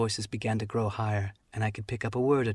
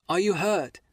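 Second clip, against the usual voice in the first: high, loud worried call; boy crying out in alarm; 8 semitones higher, 4 dB louder than usual. Acted. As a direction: cry out to the reeling captain. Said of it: "cried I".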